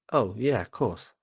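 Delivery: ordinary talk, nothing marked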